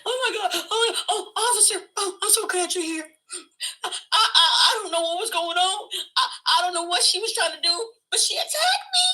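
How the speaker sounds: high, stammering fake crying; mock tearful victim. Fake victim telling the officer she was attacked, mock tearful pleading. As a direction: plead in hammed-up distress, rising to a squeal. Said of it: "I had tears coming out my eyes".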